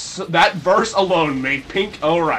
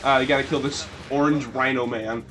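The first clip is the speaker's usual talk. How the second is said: ordinary delivery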